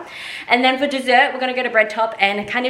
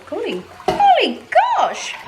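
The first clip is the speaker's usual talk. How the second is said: surprised laugh; 12 semitones higher than usual. Real, not acted. Mum says the pork cooks two hours.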